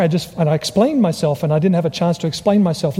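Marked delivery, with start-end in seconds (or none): none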